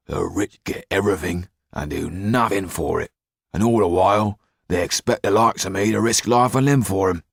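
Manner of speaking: rising, resentful rant; bitter grumbling. A narrator's reading. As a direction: complain bitterly under your breath. Jailer grumbles bitterly to himself.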